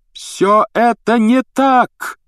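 high, slow, loud cry of protest; shouting. A narrator's reading. Harry contradicting the minister, shouting. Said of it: "закричал Гарри".

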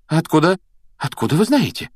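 high, startled questions; jittery broken questioning; nervous. A narrator's reading. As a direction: blurt it out, rattled. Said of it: "нервно выговорила она".

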